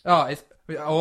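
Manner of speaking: ordinary delivery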